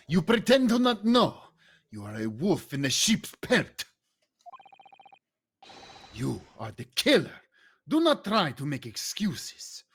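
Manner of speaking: put-on Swedish accented accusation, punchy start; lightly put-on Swedish sailor, accusing. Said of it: "Swedish guy".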